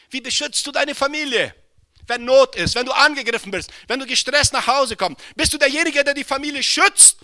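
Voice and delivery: high, loud preaching at full force; rising sermon fervour; fiery preaching. Acted. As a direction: preach it out to the whole hall. Challenging fathers from the pulpit.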